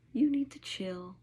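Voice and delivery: calm the human like a dog; dog telling an upset person to relax; hushed dog voice. Acted. Dog's message voiced.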